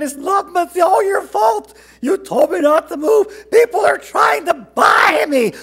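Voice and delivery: put-on high, loud child's bawl; hammed-up wailing complaint. A narrator's reading. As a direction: bawl it out like an upset kid. Child wails accusation at father.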